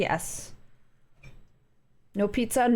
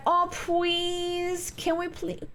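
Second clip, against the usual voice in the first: put-on baby voice; 8 semitones higher, much slower than usual. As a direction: beg sweetly like a little kid. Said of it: "He's talking with a baby voice to me".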